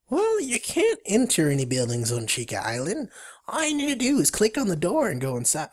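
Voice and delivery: squeaky, swooping beaver voice; Billy. Acted. Beaver explaining the buildings, squeaky critter voice. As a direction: pitch it up like a cartoon critter. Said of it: "states Billy".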